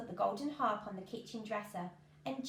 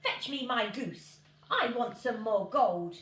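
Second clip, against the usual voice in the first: a hint of a bossy giant, louder; demanding giant; 6 dB louder than usual. A narrator's reading. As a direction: order it like a greedy giant. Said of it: "then demanded".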